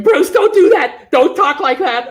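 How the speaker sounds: high, loud, slow mock scolding; imitating scolding viewers. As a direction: play a fussy viewer telling him off, laughing. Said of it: "they're watching going".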